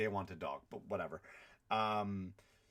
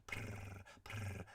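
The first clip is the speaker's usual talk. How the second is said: imitated cat purr; 3 semitones lower, 11 dB quieter than usual. Cat purring on your lap.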